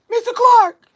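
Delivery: high loud shouted name; movie quote. Retold or imitated. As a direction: call the name out sharply, way up. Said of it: "Lean on Me".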